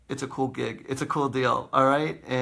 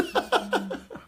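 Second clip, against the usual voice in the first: airy laugh. Real, not acted. Laughs at own mime joke.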